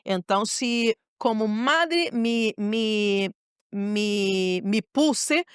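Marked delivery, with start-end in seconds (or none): none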